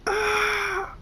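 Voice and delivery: very high, sustained scream; loud piercing shriek; pony's scream. A narrator's reading. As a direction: let out one long high scream. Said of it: "A pony screams".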